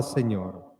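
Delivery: ordinary talk, nothing marked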